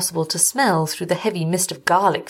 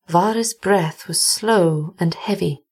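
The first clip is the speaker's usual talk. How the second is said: usual speaking voice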